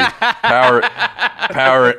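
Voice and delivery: power said high through laughter; laughing emphasis. Acted. Guy joking about power hunger, laughing on power. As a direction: laugh out the word power, stressed. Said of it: "power and racism work together".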